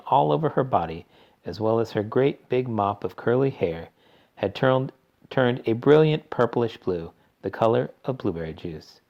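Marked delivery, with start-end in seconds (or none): none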